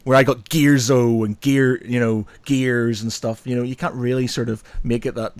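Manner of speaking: ordinary talk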